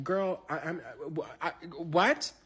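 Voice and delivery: high, stammering bafflement; speechless disbelief. Retold or imitated. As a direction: stumble over words, dumbfounded. Speechless at crying singer.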